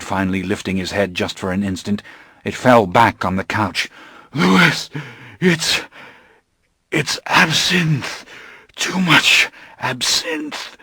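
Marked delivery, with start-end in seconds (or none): 4.3-10.8 gasping. Acted. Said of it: "he gasped".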